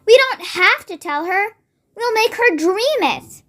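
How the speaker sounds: put-on mildly excited girl; excitedly. A narrator's reading. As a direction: share the plan with building excitement. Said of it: "Sophie said excitedly".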